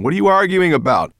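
loud, high query; calling back. A narrator's reading. Dragon asking about the quarrel, calling back. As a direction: shout back to the riders. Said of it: "the dragon called back to them".

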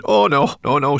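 loud, high, panicked moans; terrified moaning. A narrator's reading. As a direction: moan in terror. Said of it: "she moaned".

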